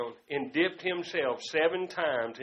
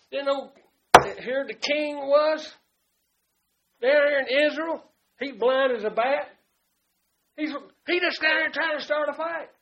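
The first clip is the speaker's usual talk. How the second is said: loud preaching; 6 semitones higher, 9 dB louder than usual. Acted.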